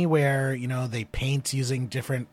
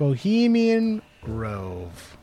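drawn out while reading; slow reading aloud; much slower than usual. Real, not acted. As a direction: stretch the words slowly. Reading search result slowly.

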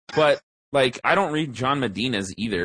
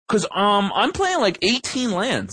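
higher-pitched, complaining casual player; mock a baffled player airing a grievance; puzzled player imitation; 8 semitones higher than usual.

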